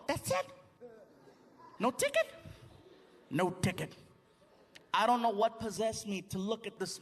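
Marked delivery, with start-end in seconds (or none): 0.1-2.3 high squeaky disbelief; mock squeak of surprise; astonished. Himself astonished at no ticket. 3.3-3.9 low, falling confirmation; low matter-of-fact officer voice; calm cop. Retold or imitated. Cop confirms, against squeaky question.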